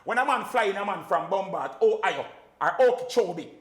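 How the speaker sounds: fired-up rant; animated rant. Real, not acted. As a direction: hammer the point home. Animated rant on local selectors.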